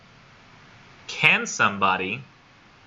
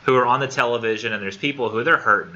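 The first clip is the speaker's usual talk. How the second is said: ordinary talk, nothing marked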